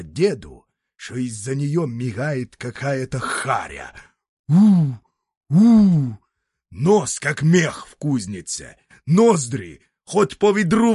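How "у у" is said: spooky ooh. A narrator's reading. Narrator spooks at monster face.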